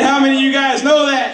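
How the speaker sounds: high, loud call for a response; preacher's call. Shown as a demonstration. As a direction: demand an answer from the crowd, shouting. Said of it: "I said".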